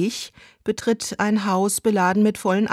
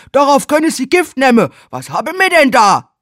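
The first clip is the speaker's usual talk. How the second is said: much louder, higher cheesy boast; loud hammy leer; leering workman; 6 semitones higher, 10 dB louder than usual. Acted. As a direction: play the porn stud.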